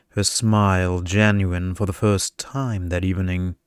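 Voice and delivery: plain reading voice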